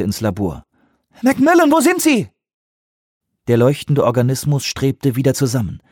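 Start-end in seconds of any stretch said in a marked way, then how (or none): 1.2-2.3 high, loud searching call. Acted. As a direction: call into the lab. Searching for the soldier.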